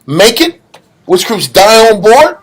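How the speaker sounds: normal talking voice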